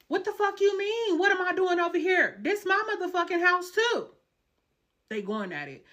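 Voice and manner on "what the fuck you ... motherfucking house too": high, loud angry woman's retort; mock furious shout from the other woman. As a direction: snap back at him like the furious girlfriend.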